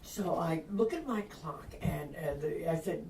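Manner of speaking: usual speaking voice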